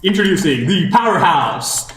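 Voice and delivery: slow, booming arena announcer over a speaker; crackly commentator's box PA voice; tinny announcer voice. Acted. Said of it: "you hear a tinny, uh, voice from a commentator's box".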